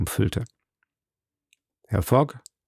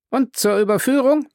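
much higher, presenting voice; court usher; 13 semitones higher than usual. A narrator's reading. Usher presenting evidence to the judge, high announcing voice. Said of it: "fügte der Gerichtsdiener bei".

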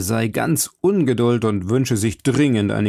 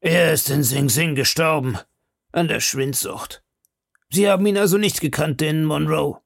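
higher, chatty and confiding; gossiping grub-man; 6 semitones higher than usual. Acted. Grub-man to the lawyer, talking forgers, higher and confiding. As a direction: lean in and share prison gossip.

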